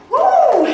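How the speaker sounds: high, loud whoop of effort; excited whoop. Real, not acted. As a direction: let out a sharp whoop. Coach cheering mid-workout.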